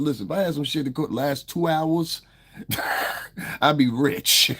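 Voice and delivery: high voice breaking into laughter; laughing. Real, not acted. Streamer joking about selling long-lasting drugs, laughing. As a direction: crack up at your own punchline.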